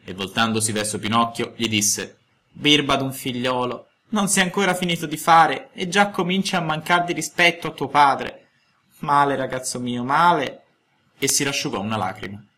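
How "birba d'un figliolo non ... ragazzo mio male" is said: a hurt father near tears; sad reproach. A narrator's reading. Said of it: "si fece triste e melanconico".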